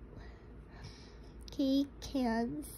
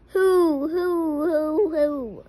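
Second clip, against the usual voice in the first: owl hoot; 3 semitones higher, 8 dB louder than usual. Shown as a demonstration. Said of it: "He can sound like a owl".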